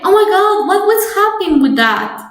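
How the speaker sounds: high, exaggerated "Oh my God" gasp; mock surprise. Teacher voicing a confused student, mock surprised. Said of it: "And you could say".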